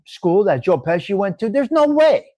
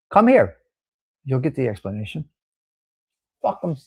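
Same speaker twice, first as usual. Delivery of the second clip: ordinary delivery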